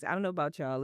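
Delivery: usual speaking voice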